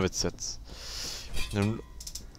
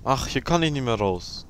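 usual speaking voice

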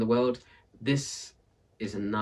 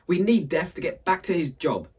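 higher, louder insistence; urgent plea; 8 semitones higher, 6 dB louder than usual. Acted. Villager insisting to Aunt Misery, higher urgent. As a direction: push the demand hard.

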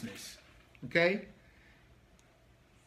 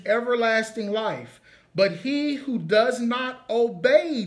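measured, emphatic Bible reading; scripture reading; 4 semitones higher, 5 dB louder, slower than usual. Acted. Preacher reading John to the congregation, measured scripture reading. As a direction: read the verse out slowly, leaning on key words.

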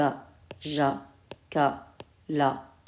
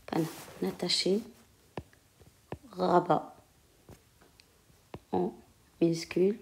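murmuré lentement, mot après mot, en écrivant au tableau; à voix basse en écrivant; 11 dB quieter than usual. Real, not acted. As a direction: dicte tout bas pour soi, avec de longues pauses. Dictates softly while writing on board. (la maîtresse écrit au tableau et se dicte les mots, à voix basse)

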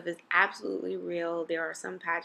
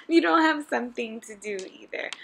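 laughing; 9 semitones higher, 11 dB louder than usual. Real, not acted. Laughing encouragement to boys.

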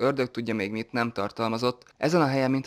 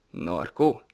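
sly teasing; 5 semitones lower than usual. A narrator's reading. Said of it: "kaján mosollyal".

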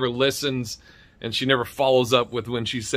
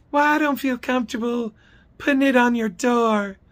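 high mock office lady; high-voiced caricature making excuses; Dee's high-pitched voice; 10 semitones higher than usual. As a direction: mimic a reluctant clerk, pitched way up. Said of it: "She has this high-pitched voice".

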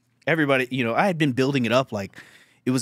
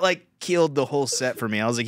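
normal talking voice

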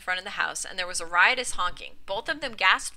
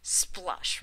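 scornful hiss; 5 dB quieter than usual. A narrator's reading. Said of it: "remarked Marjorie again".

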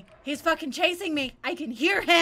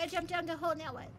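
ordinary delivery

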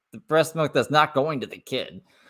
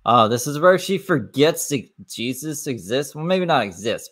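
ordinary talk, nothing marked

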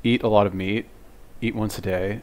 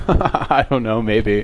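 laughing; 7 dB louder, much slower than usual. Real, not acted. Amused doubt about young girls.

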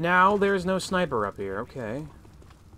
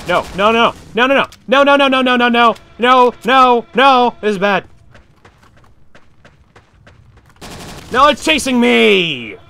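being chased; 8 semitones higher, 11 dB louder than usual. Real, not acted. No, it's chasing me!.

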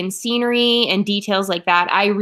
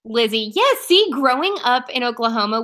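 ordinary talk

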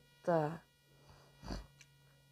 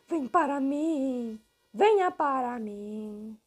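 slow luring voice; 4 semitones higher, much slower than usual. Acted. Luring the toy, slow odd voice.

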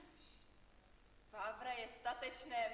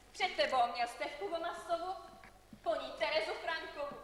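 spiteful emphasis; 5 semitones higher, 6 dB louder than usual. Acted. Aunt spitefully lists Francek's girls.

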